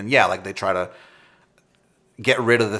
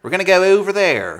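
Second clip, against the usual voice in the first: higher-pitched, exaggerated Maryland regional accent; mock Maryland accent; 8 semitones higher, 3 dB louder than usual. Local mimicking radio talkers back home, mock Maryland accent. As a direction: imitate a silly Maryland accent. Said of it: "you hear people talk like that".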